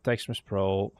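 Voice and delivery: normal talking voice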